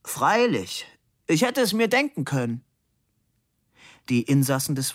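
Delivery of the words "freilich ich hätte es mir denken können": high, raised voice; loud, high-pitched, nodding agreement; smiling reply. A narrator's reading. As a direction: smile and play the satisfied listener. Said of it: "Labude nickte lächelnd und meinte".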